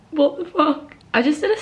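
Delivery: high, loud incredulous exclamation; can't believe what she just did; stunned disbelief. Real, not acted. Student shocked at her own speed, loud disbelief.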